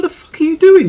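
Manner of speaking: high, loud re-enacted scream; shocked furious yell; retold scream. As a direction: scream it at the kids like it's happening again. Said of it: "And I screamed at them".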